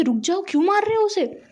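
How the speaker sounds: high, urgent cry to stop; alarmed plea. Retold or imitated. Parents pulling their son off his brother, a high alarmed plea. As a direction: pitch it high, pleading him to stop.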